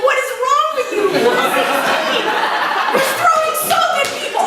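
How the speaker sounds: shrieking high, laughing disbelief; losing it. Retold or imitated. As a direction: lose it completely, voice cracking up. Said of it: "And I'm losing it".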